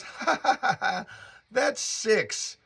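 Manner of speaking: high, giggly mock laugh leading into the name; imitating her laugh. Girlfriend laughing off the witch remark, imitated giggle. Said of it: "And she laughs".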